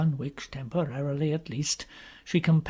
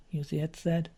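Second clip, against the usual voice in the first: ordinary delivery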